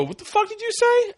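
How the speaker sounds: very high indignant outburst; high-pitched mock fury; retold angry challenge. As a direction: act out an outraged comeback. Retold confrontation with rude driver.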